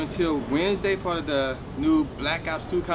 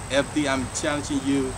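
normal talking voice

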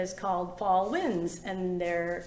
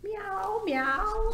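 high mewing meows; cat meow; 9 semitones higher than usual. A narrator's reading. Teacher voicing a cat for the kids, high meows. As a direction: mew like a cat. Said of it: "said Cat".

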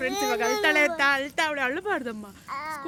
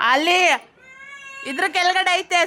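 calling out; 6 semitones higher, 5 dB louder than usual. Real, not acted. Calling back where slippers are.

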